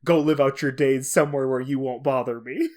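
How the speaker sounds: usual speaking voice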